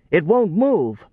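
a harried workman pleading; flustered foreman. Acted. Foreman voiced, distinct.

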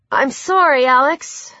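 understated, helpless regret. A narrator's reading. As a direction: apologise with a touch of helplessness. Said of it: "Luke said helplessly".

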